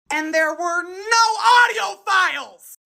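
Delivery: high, drawn-out cry of disbelief; exasperated high-pitched exclamation; outraged wail. Real, not acted. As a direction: stretch it out high for the punchline. Outraged punchline of the story.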